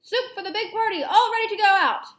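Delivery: high, loud kitchen call; shouted announcement. Acted. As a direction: call it out across a busy kitchen. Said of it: "put the lid on the basin and shouted".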